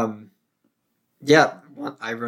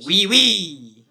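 higher, louder cartoon French; French impression; 6 semitones higher, 6 dB louder than usual. Asked to hear his French, cartoon French accent. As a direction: chirp it like a stage Frenchman. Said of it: "You wanna hear my French?".

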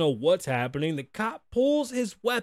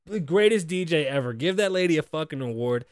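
ordinary delivery

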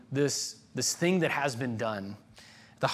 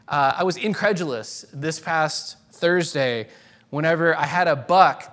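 usual speaking voice

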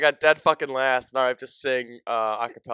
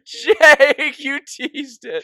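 excited; 9 semitones higher, 10 dB louder, much slower than usual. Real, not acted. Excited teasing over sponsor tease.

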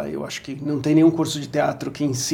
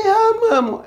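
mock delight; 3 dB louder than usual. Answering the actress, retold.

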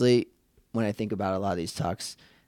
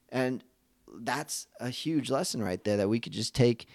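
ordinary talk, nothing marked

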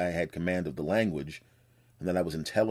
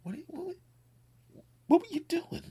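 much higher, incredulous what; disbelief; 3 dB quieter than usual. Retold or imitated. Store people as he walks out: high incredulous question. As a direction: squeak out your disbelief. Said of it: "with disbelief".

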